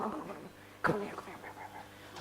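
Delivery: soft, fading into a whisper; whispered coaxing. Real, not acted. Coaxing a child quietly.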